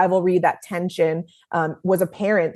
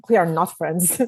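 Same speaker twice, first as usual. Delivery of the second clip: put-on Russian accent; 3 semitones lower than usual. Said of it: "That's my Russian accent".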